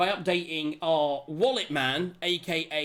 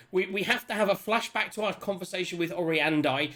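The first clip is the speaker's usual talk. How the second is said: usual speaking voice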